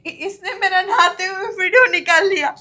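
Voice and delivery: a widow loudly exposing her brother-in-law to his father; loud accusation. Acted. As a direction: expose him for everyone to hear. Victim exposes her abuser.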